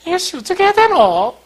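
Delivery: loud, high-pitched cry of exasperation; exasperated outburst. Retold or imitated. Pastor at a loss after criticism, comically exasperated. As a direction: blurt it out, hammed-up frustration. Acts his frustration at criticism.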